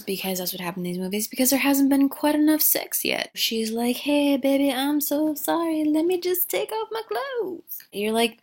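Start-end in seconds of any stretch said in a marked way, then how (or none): 4.0-7.8 high, sugary put-on come-on. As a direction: coo an apology in a climbing high voice.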